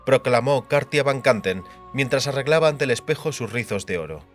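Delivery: plain reading voice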